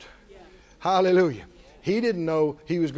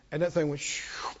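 whispered whoosh; 3 semitones lower, 7 dB quieter than usual. Retold or imitated. Stone flying.

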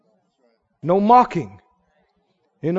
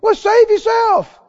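high, slow scoffing challenge; the thief sneering at Jesus; drawn-out high jeer; mocking tone; 10 semitones higher, 4 dB louder, slower than usual. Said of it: "Same tone".

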